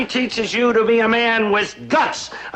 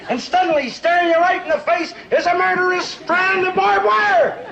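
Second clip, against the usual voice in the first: dramatic build; 7 semitones higher than usual. Acted. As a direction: pile on the drama to the punch. Father's dramatic war story.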